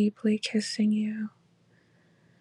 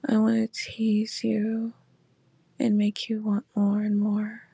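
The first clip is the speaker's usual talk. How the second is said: right in your ear. Acted. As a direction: close intimate whisper. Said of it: "my voice in your ear".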